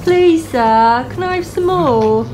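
loud, pleading put-on British accent; British orphan's plea, high and insistent. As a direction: beg like an orphan in hammed-up British English. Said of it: "That's my British accent for all of you".

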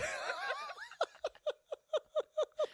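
squealing laugh, very high; high shrieking laugh. Real, not acted. Bursts into laughter.

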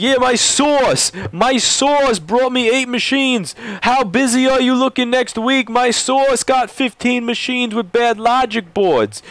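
slow, high mock Italian-American mobster voice; a hammed-up Italian-American Soprano accent; Italian Guido accent. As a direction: drag it out like a Soprano wiseguy. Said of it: "using an Italian, like, Guido Soprano's accent".

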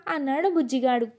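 plain reading voice

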